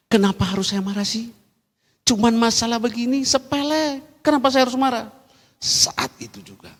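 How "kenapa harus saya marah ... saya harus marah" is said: high-pitched, incredulous self-reproach; exasperated self-questioning. Retold or imitated. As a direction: act out asking yourself in exasperation. Acts out questioning own anger. (heran dan kesal pada diri sendiri)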